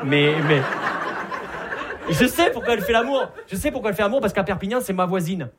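excited comic shout; loud punchline. Acted. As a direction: shout the setup to sell the joke. Punchline build-up on stage.

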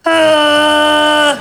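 flat droning meow of a deaf cat; a cat that can't hear its own meow, held long and flat. Retold or imitated. Comic imitating her deaf cat's meow, a long flat drone. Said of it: "il miaule comme ça".

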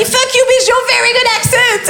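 high, hammed-up shouted insult; insulte criée. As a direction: shout it high in comic anger. Le SDF l'insulte en anglais. (insulte criée d'une voix suraiguë)

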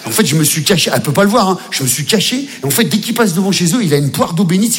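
ordinary talk

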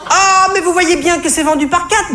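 voix de vendeuse très aiguë, agacée; vendeuse qui glapit, agacée. Acted. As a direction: glapir d'exaspération, perché très haut. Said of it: "glapit-elle au sortir d'un soupir agacé". (glapissement agacé de vendeuse)

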